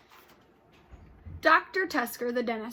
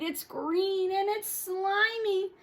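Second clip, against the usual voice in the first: put-on slow, higher kid's whine of disgust; higher, slow whiny grossed-out kid; 9 semitones higher, 4 dB louder, much slower than usual. Child whining about seaweed.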